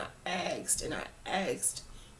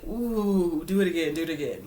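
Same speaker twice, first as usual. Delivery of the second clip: louder teasing hyena jeer; mocking hyenas; 5 dB louder than usual. Hyenas tease Simba about his cute growl, loud jeering. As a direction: taunt him like a mocking hyena. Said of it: "the hyenas was like".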